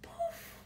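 a high, airy little "poof" like a magic trick vanishing; playful poof. Acted. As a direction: pop the word "poof" up high and light. Playful magic sound word.

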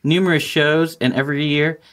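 smooth, upbeat sponsor read; mock ad read. Host reads a joke sponsor spot for the listeners, polished and upbeat. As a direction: sell it like a podcast ad spot.

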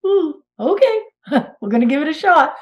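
high-pitched "ooh, okay" with a little laugh; game but unsure. Retold or imitated. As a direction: nervously agree to have a go. Acting out her hesitant agreement.

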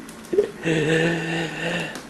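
can't help laughing; helpless, quiet run of giggles; real laughter. Real, not acted. Filmer cannot help laughing.

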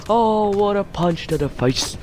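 high-pitched commentator outburst; excited exclamation. Real, not acted. Exclaiming at a punch in game.

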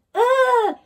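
very high loud yelp; yell. A narrator's reading. Jemima yelping as she's booted down the corridor, high. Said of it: "she yelled".

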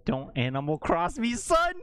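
builds from teasing to a high shouted "son"; mock taunt yell. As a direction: throw the challenge and bark the last word. Rehearses tweet as a taunt.

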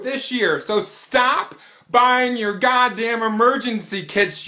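ordinary delivery

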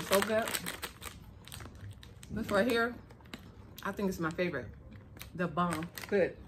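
chewing between words; talking while chewing. Real, not acted. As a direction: mouth partly full. Seen 5: Eats a nut at -3; lips work closed-mouth while holding the bag.

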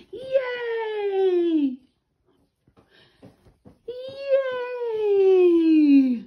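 high, loud, delighted yay; excited cheer. Real, not acted. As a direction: cheer for the bird. Cheering the pet bird.

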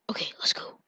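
soft whispered go. Real, not acted. Player whispers to himself before heading out, whispering. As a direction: whisper it like sneaking off.

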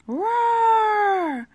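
loud, high storybook roar; bear roar. Acted. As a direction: roar like a bear bursting from a cave. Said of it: "a large growling sound from inside the cave".

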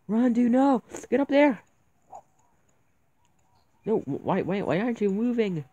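yelling in panic at the screen; panicked shout. Real, not acted. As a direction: shout it out, desperate. Character stuck while being chased.

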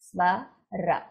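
"swa", "ra" clipped short, each on its own; short syllables. Shown as a demonstration. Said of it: "if you look at the words here itself".

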